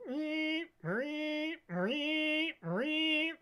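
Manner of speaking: high mock alarm meeps; alarm beeping. Alarm going off.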